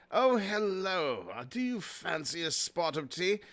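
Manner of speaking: chatty, hammed-up British accent; put-on British accent. Man chatting to Dollar Tree customers, put-on British accent. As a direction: play a tea-loving Brit. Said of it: "I noticed he had an accent".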